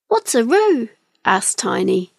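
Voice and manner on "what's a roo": curious little kiwi. A narrator's reading. Tiny asks about unknown animal.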